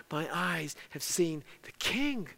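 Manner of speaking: slow, low and soft, in awe; hushed cry of Isaiah. Retold or imitated. Preacher quoting Isaiah's last line in verse five, hushed reverence. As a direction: let it out slowly, almost under the breath. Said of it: "And Isaiah cried out".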